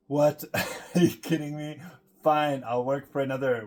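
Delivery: loud, laughing mock disbelief; acted incredulous outburst.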